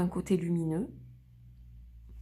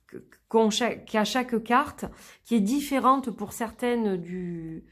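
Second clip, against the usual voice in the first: usual speaking voice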